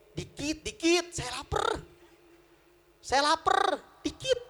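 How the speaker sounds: high-pitched wheedling for food; hungry pleading. Acted. Hungry Nuaiman begs his companion for bread, pleading. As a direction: beg insistently, voice pushed up high.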